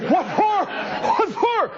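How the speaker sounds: high, laughing mock of the president's puzzled reply; mock Clinton reply. Host mocking the president's reply to the reporter, high and laughing. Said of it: "And he says".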